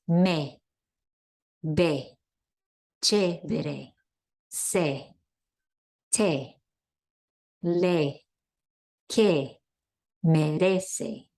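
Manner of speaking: each word slow with short clear e; Spanish e words. Shown as a demonstration. Teacher modelling words for students to repeat. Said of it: "Repeat, please".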